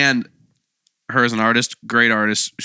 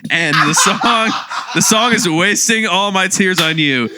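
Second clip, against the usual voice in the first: high, slow announcement through laughter; laughing while talking; 8 semitones higher, 3 dB louder, slower than usual. Real, not acted. Revealing a song pick to the cohost, laughing. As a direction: crack up while getting the title out.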